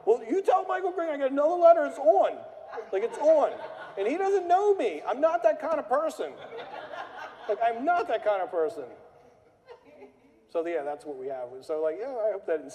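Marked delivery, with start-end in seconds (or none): 0.0-8.9 much higher, louder laughing rant; mock furious caller. Caller telling the receptionist it's on, laughing mock rage. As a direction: declare war over a letter, hammed-up. Angry caller declaring war.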